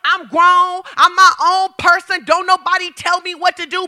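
mock defiant high loud claim; defiant grown-up. Preacher imitating people insisting they're grown, defiant. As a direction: play the know-it-all insisting they're grown. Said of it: "people quick to tell you how grown they are".

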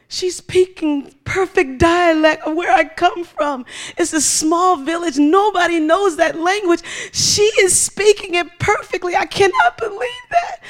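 loud, high, overwhelmed cry; mock tearful astonishment. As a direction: wail in disbelief like the astonished woman. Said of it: "She's crying".

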